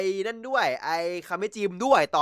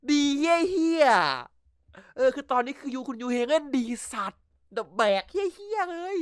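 excited; 7 semitones higher, slower than usual. Real, not acted. Raving about the actor's performance.

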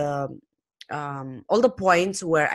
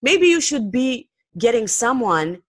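imitated mocking advice, pitched up; lightly mock with a raised pitch; 5 semitones higher than usual. Podcaster quoting a commenter's advice, mildly mocking. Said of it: "in a mocking voice".

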